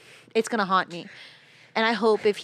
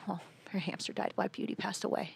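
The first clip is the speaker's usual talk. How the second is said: whispered, almost unvoiced explanation; hushed mom voice; 12 dB quieter than usual. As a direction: breathe it softly to the nun, mom gently breaking sad news. Mom explains hamster to nun.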